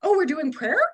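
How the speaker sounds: high, uncertain surprise; taken aback. Retold or imitated. Non-religious newcomer reacting to sudden prayer, taken aback. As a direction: caught off guard, going along with it.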